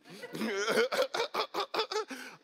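high, drawn-out staccato laugh; comic wailing laugh. Acted. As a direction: laugh it out in high, stuttering bursts. Reacts to God's restaurant instruction.

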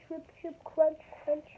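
usual speaking voice

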